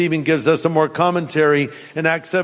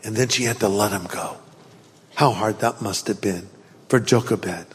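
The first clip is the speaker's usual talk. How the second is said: softer, lower and tender; tender, hushed; 8 semitones lower, 4 dB quieter than usual. Real, not acted. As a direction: lower the voice with compassion. Sermon turns tender.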